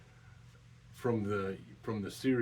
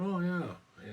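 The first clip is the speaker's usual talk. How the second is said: excited oh yeah; 10 semitones higher, 6 dB louder than usual. Retold or imitated. Retold reaction to seeing Hot Wheels.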